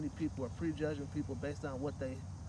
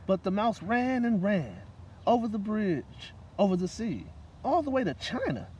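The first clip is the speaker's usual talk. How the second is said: put-on reading to children; 4 semitones higher, 8 dB louder than usual. A narrator's reading. Reading a picture book aloud to children at story time. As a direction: read it aloud to a room of kids.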